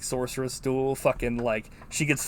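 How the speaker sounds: ordinary talk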